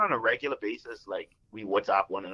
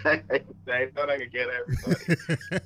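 laughing; 4 semitones higher than usual. Shown as a demonstration.

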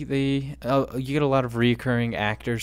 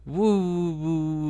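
high eerie ghostly woo; spooky ghost woo; 5 semitones higher than usual. Acted. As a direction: moan it like a ghost. Spooky build-up to 1666.

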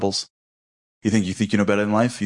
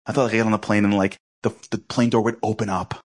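ordinary delivery

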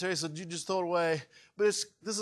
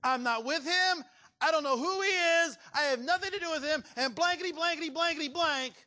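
much higher, heated Peter swearing he doesn't know him; Peter's cursing denial; 11 semitones higher than usual. Retold or imitated. Preacher acting Peter denying Jesus, high heated voice. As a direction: spit out the denial, blanking the curses. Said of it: "Peter stood there and said".

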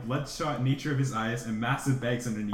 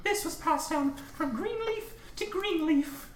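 mom's voice. Acted. Mother to her son, gently passing on heirloom.

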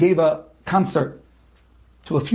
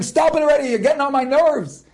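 retold angry yell; 9 semitones higher than usual. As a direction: snap back at him over the gunfire. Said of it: "I'm screaming back to him".

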